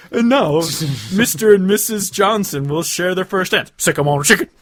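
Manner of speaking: loud, high, hammed-up announcement; mock wedding announcer. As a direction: proclaim it grandly to the wedding guests. Imagined wedding announcer introduces first dance.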